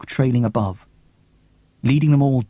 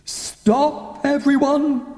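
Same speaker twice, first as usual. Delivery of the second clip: sharp raised command; commanding call; much slower than usual. Acted. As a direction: stop everyone in their tracks. Cressy halts the group.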